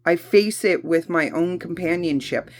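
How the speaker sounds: ordinary talk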